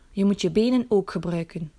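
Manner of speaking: usual speaking voice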